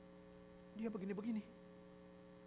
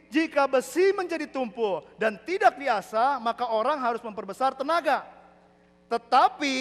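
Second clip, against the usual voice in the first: loud preaching read; declaimed scripture; 7 semitones higher, 10 dB louder, slower than usual. Real, not acted. Preacher reading Ecclesiastes to congregation, loud declaiming. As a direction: read the verse out at full force.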